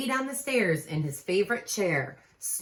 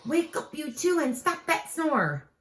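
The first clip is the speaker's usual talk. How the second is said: higher pleading wake-up call; lightly voiced Jack calling; a hint of a kid calling out; child calling out; 11 semitones higher, 3 dB louder than usual. Calling the twins.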